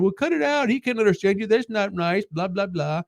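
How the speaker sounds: high mock scolding girl's voice, lightly done; mock scolding girls. Girls scolding, retold in their voice.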